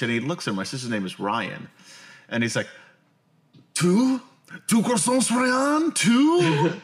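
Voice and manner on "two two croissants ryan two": high, loud mocking French accent. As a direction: sneer it in a weird French accent. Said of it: "said it with a weird French accent".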